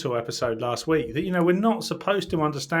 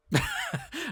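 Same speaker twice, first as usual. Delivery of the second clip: high laugh; 5 dB quieter than usual. Real, not acted. Host jokes about no news.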